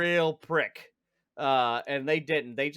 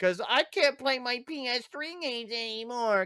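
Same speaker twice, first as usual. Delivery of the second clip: high-pitched mock whine; imitating a whiny crybaby gamer; mock crying; 10 semitones higher than usual. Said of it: "and they cry".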